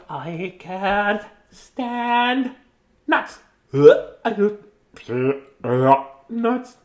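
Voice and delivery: high squirrel gone nuts, then fed up. Acted.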